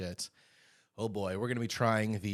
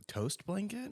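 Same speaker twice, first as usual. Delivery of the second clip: puzzled; 10 semitones higher, 4 dB quieter, slower than usual. Real, not acted. Puzzled echo of odd name.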